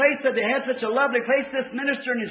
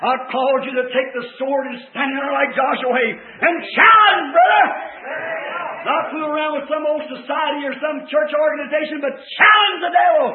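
rising, shouted charge to battle; fired-up preacher shouting the commission; full-force preaching; 4 semitones higher, 7 dB louder than usual. Real, not acted. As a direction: build to a loud, high cry, like a rallying call.

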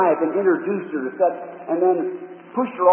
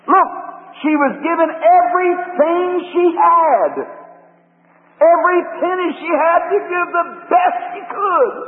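high, slow, forceful sermon delivery; raised preacher's voice, stretching key words; impassioned preaching; 8 semitones higher, 4 dB louder than usual. Real, not acted. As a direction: drive the challenge home to the congregation. Preaches to the congregation.

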